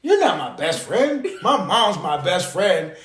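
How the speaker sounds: imitating a kid's outraged reply; indignant little boy. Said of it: "He was like".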